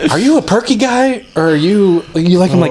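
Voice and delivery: imitating Ross. Ross quizzing him over the screens.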